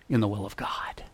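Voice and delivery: hushed whisper of the promise; sudden whispered close. Acted. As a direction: drop to a whisper to draw them in. Preacher drops to a whisper.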